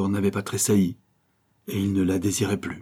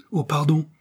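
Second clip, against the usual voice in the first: high-pitched, startled apology; startled exclamation; 8 semitones higher than usual. A narrator's reading. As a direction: blurt it out high, caught off guard. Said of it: "s'écria-t-il". (exclamation de surprise)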